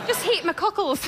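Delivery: put-on lightly done Scottish accent of a mum; a hint of a Glasgow mum's Scottish accent. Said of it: "I should never do a Scottish accent".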